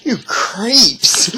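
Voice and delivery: hammed-up creeped-out whine. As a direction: recoil in mock disgust. Whole restaurant greets him by name.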